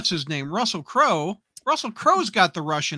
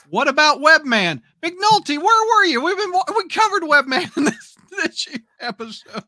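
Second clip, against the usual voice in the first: high, loud mock indignation; playful loud scolding of a listener; mock outrage; 8 semitones higher, 6 dB louder than usual. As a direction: call him out in fake disbelief.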